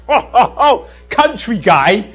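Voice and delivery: loud, laughing disbelief; mock scoffing. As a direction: scoff at the country bumpkin, hammed-up. Southerners scoffing at a country preacher.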